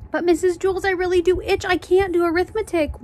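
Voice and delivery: high-pitched pleading whine; insistent, whiny protest; whining. Acted. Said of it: "Dana whined".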